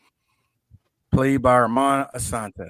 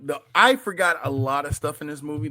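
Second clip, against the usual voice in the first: ordinary talk, nothing marked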